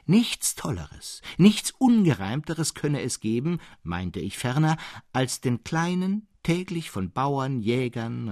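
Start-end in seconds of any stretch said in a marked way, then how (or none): none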